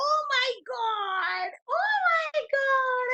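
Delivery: squealed, drawn-out excited gasp. Shown as a demonstration. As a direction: act thrilled to model the face. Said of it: "teacher showing excitement to kids".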